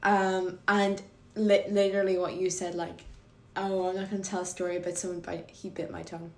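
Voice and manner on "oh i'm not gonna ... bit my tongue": ordinary delivery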